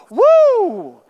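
loud, high joyful whoop; lottery whoop. Retold or imitated. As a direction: cheer like a jackpot winner. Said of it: "If you win the lottery, it's like".

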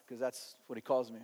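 normal talking voice

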